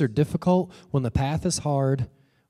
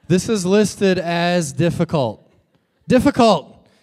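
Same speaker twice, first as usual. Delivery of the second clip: higher, louder comic indignation; mock outrage; 3 semitones higher, 5 dB louder, slower than usual. Hiker finds the trail listed difficult, indignant. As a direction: protest as if cheated, punching it out.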